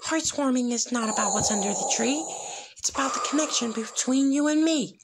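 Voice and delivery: put-on high, earnest girl's voice; a small pony pleading from the heart; little filly voice. A narrator's reading. Filly explains friendship to Vader, high and earnest. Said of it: "began to explain".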